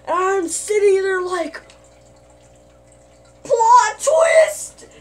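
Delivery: high, loud, excited outburst; Jeremy impression. Vlogger reacts to the twist, yelling like Jeremy. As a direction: yell the twist like a hyped youtuber. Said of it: "I just sounded like Jeremy T and M there".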